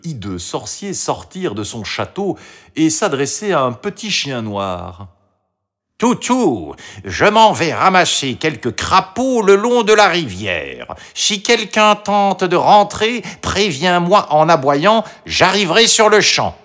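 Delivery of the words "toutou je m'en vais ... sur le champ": hideous sorcerer. A narrator's reading. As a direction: give orders as a high-pitched villain. Hideous sorcerer orders his dog.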